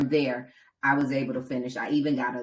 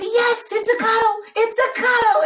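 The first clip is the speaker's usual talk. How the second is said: very high, loud imitation of husband; excited exclamation; 8 dB louder than usual. Husband as runner reaches out her arms, imitated excited cry. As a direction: cry it out in delighted triumph.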